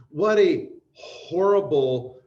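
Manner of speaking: slow, loud drawn-out exclamation; emphatic. Real, not acted. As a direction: stretch it out in dismay.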